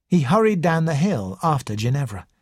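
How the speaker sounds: usual speaking voice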